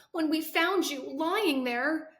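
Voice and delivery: tearful. Acted. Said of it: "breaks down crying".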